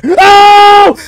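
very high, loud scream of "no". Real, not acted. Player jump-scared in a horror game, screaming. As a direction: scream it out in real fright.

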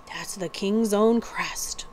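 slow, hushed whisper; awed whisper. Acted. The outlaw whispers over the royal crest, hushed and slow. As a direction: breathe it out in awe.

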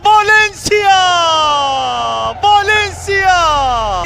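drawn-out goal scream, loud; screaming football commentator. Real, not acted. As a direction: yell the scorer's name, stretching it. Commentator screaming scorer's name. (çığlık atan futbol spikeri)

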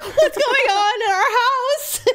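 high, drawn-out mock panic; distressed wail. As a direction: wail as if the house is invaded. Distraught after finding hairy spider.

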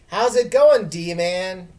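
high, loud, cheerful hello; grinning greeting. Acted. Trudy greets Dylan with a grin, loud cheerful hello. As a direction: beam as you greet the little brother. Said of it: "grinned Trudy".

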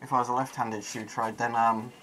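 muffled behind face mask; through a gas mask. Real, not acted. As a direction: voice through respirator. Seen 3: Full-face gas mask worn throughout; reference frames show bare face.

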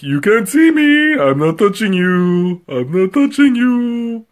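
high, slow sing-song taunt; mock playground taunt; teasing kid. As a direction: tease like a bratty child.

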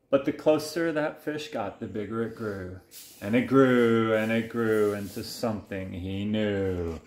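low, lingering build-up; hushed-to-ominous picture-book suspense; suspenseful story narration. A narrator's reading. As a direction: stretch it out toward a big reveal. Builds suspense reading to child.

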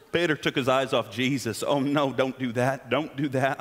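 low mock Southern preacher accent; horrible Southern accent. Imitating preachers heard on the radio, bad Southern accent. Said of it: "doing a horrible Southern accent".

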